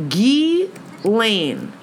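"Ghislaine" in two separated beats, gee... LANE; silent S, syllable by syllable. Shown as a demonstration. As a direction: try out "Ghislaine" slowly without the S.

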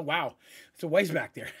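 ordinary talk, nothing marked

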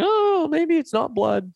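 high, slow hammed-up hopeful denial. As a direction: squeak it out like you're kidding yourself. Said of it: "keep drilling away and going".

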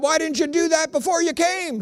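high, put-on pastor's demand; imitated Southern pastor. Pastor questioning his departing friend, high and indignant. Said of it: "He said".

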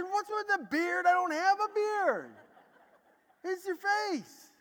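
high, exasperated, slowing at the end; exasperated protest. Retold or imitated. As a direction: push back in disbelief. He protests to the students.

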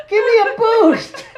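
high, loud, drawn-out shout; excited mock shout. Retelling a kid at the windows, high drawn-out shout. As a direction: belt it out high, stretching the words. Acted shouted request in story.

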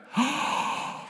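sharp breathy gasp of shock; crowd gasp. Pretend. Preacher acts the stunned crowd, gasping. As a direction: gasp as if the crowd just heard it.

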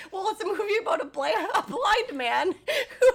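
retold line through big laughter; laughing. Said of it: "And she says".

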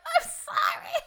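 high squealed wail; mock tearful apology. Viewer as Appa flies off, mock wailing apology. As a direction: wail it through a laugh.

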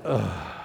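low heavy groan; a tired congregation groaning together; group groan. Shown as a demonstration. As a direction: sigh out everything you have left. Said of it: "let's just do that together on three".

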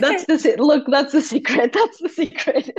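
laughing through the words; a real laugh over a joke about her own hair. Real, not acted. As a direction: giggle it out between words. Joking about unwashed hair.

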